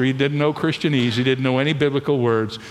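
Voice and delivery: usual speaking voice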